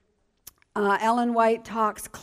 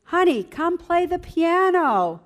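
put-on higher, coaxing old lady voice; an older church lady coaxes the young wife to play, higher and sweetly; 7 semitones higher, 3 dB louder than usual. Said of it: "she said to me".

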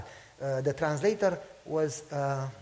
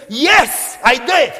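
joyful shout; 6 semitones higher, 15 dB louder, much slower than usual. Retold or imitated. Excited man answers yes.

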